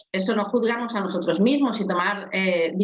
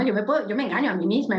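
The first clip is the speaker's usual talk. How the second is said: ordinary delivery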